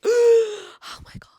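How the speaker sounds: loud slow gasp of awe; breathless oh my God, loud and slow; gasping swoon. Real, not acted. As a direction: gasp as if floored. Swooning over male characters.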